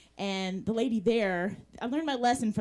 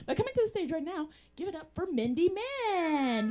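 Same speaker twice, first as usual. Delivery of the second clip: stage introduction; 6 semitones higher than usual. Real, not acted. Host calling Mindy Mann to the stage, announcer-style introduction. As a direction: bring the next act on, stretching the name.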